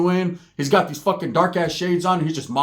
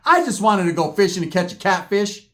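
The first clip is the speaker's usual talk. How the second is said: plain reading voice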